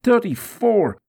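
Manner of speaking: loud, high-pitched cry; pained shout as the lash falls; agonised count. Acted. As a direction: cry out the number in pain. Counting lashes during the scourging.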